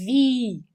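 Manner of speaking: vi with a long held ee; prolonged I. Shown as a demonstration. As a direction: stretch the vowel of vi. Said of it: "um I I mais prolongado". (vi com o "i" prolongado)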